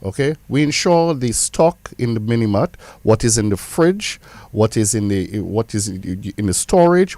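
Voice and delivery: usual speaking voice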